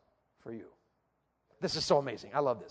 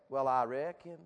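Southern drawl; 4 semitones lower, slower than usual. Shown as a demonstration. Preacher showing how to say it properly, Southern drawl. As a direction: lower Southern drawl, stretched out. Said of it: "put a little Southern in it".

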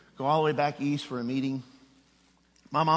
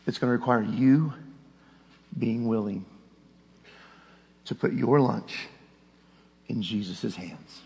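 tender appeal; 4 semitones lower than usual. Acted. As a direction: lay it gently before them.

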